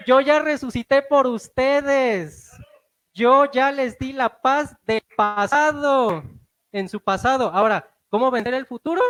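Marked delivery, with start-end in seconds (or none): 0.1-6.2 slow, loud proclamation as Jesus; voicing Jesus. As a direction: declare it slowly and forcefully. Preacher voicing Jesus, emphatic. (Jesús proclamando lento y fuerte)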